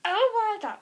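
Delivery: high, loud "Oh my God"; excited gasp. Retold or imitated. Seeing her result, loud excited disbelief. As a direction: blurt it out in disbelief. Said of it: "I was like".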